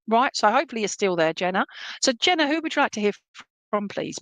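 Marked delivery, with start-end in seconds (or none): none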